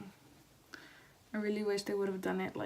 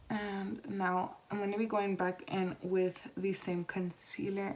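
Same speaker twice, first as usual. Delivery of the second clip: normal talking voice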